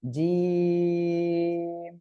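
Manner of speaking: জিম with the "ee" held long on a level note; long drawn-out. Shown as a demonstration. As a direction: intone জিম very slowly, closing on m. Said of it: "তিন আলিফ টেনে পড়তে হবে".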